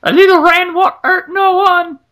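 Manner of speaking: put-on high, childlike French kid; squeaky little French child. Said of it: "when you've got the little French kids saying stuff like".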